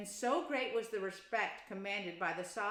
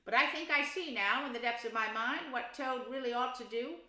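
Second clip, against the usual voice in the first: Rat voice; 4 semitones higher, 6 dB louder than usual. Acted. Rat explains.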